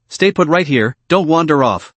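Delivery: ordinary delivery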